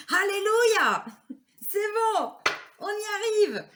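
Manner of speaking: high, loud cry of relief; bursting with happy relief; joyful alleluia. Real, not acted. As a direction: cheer it out, thrilled it finally worked. Relieved cards finally fell out.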